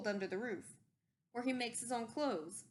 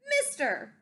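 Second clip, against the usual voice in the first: put-on louder, bossy call of a little old creature; sharp, bossy Lorax; 7 dB louder than usual. A narrator's reading. Lorax calls out to the Onceler, sharp and bossy. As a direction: snap for attention like a bossy elder. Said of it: "a voice that was sharpish and bossy".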